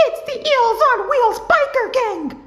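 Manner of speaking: high, loud frightened shriek; panicked cry. A narrator's reading. SpongeBob spots the biker gang, shrieking high and loud. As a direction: shriek the gang's name in panic.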